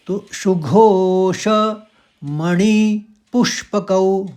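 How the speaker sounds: सुघोष, मणि, पुष्पकौ slow, in three parts; three word groups. Shown as a demonstration. Teacher recites the fourth quarter in three parts. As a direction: bind the line into three words. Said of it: "शौ का एक हल्का सा sound".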